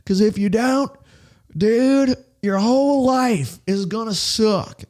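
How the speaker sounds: mock a pushy zealot, high and over the top; crazy preachy guy. Mocking a pushy preacher he fears sounding like, in a high put-on voice. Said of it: "not sound like a crazy guy".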